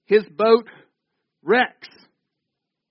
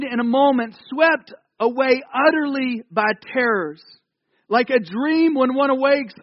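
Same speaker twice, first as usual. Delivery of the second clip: raised-pitch psalm reading; scripture proclamation; 5 semitones higher than usual. A narrator's reading. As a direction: proclaim the psalm in a high, declamatory voice. Reads psalm aloud.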